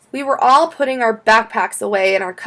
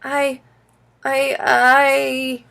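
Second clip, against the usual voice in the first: halting, higher-pitched stammer; 5 semitones higher, 4 dB louder, much slower than usual. A narrator's reading. Child questioned by mom in the garage, stammering. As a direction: stall on one word, stretching it out. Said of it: "I stammered".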